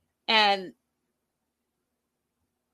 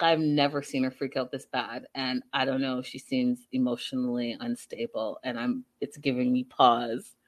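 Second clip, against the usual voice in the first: much lower, quieter mock-concerned man; worried Gino; 11 semitones lower, 3 dB quieter than usual. Retelling Gino confiding his worry about Jasmine, low and hushed. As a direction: lightly voice him, low and uneasy. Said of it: "he really concerned about it".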